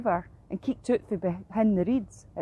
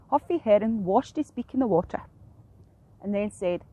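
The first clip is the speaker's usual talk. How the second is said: ordinary delivery, nothing marked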